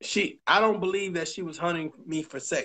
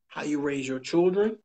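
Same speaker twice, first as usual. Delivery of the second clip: ordinary talk, nothing marked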